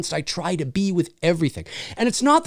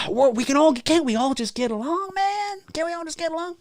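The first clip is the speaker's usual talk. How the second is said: higher and fading softer; soft pleading; 9 semitones higher, 4 dB quieter than usual. Pretend. Begging viewers for peace, soft rising plea.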